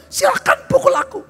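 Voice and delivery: a preacher hurling a challenge at Satan; defiant shout. Pretend. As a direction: dare the devil to hit you. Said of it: "jangan saudara diam".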